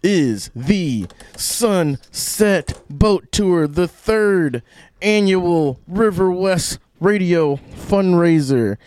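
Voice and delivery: radio ad read. Acted. Promo for boat tour.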